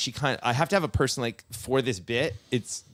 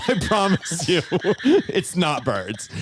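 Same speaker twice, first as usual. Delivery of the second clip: amused, drawn-out mock reassurance; laughing reassurance; 4 semitones higher, 4 dB louder, slower than usual. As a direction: promise through laughter, slowing at the end. Reassures her while laughing.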